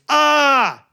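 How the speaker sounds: sharp high yelp, loud and short; a sudden cry from the painter as the ball hits; startled loud yelp. Retold or imitated. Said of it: "we heard this".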